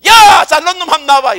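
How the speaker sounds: loud, high taunting shout; acted giant's bellowed dare; Goliath's challenge. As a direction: call out a challenger, full voice. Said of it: "골리앗은 말이야".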